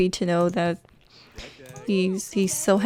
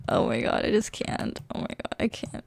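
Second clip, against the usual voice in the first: breathless whisper, barely voiced; overwhelmed whisper; 9 dB quieter than usual. Real, not acted. Touched by the gift, she whispers to herself in disbelief. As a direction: whisper it like the feeling is too much to say aloud.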